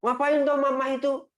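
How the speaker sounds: put-on high whiny child complaining about parents; angry child. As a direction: act the kid sulking at being told off. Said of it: "itu kita malah marah".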